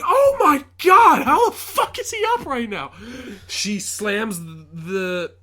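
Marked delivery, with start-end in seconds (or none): 0.0-2.9 very high, loud re-enacted outburst; incredulous shriek of a stunned viewer; shocked disbelief. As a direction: shout it in hammed-up horror, stretching the start. Own shocked reaction, re-enacted.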